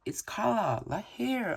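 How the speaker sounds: high called reply; calling back. A narrator's reading. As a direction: call back across the beach. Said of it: "I call back".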